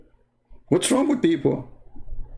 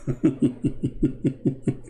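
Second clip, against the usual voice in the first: soft laugh; 4 dB quieter than usual. Real, not acted. Laughing at the story.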